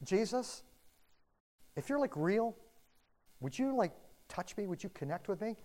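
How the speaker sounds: soft, hushed plea to Jesus; quiet prayer. Retold or imitated. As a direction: pray it softly, like an honest ask. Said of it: "and go".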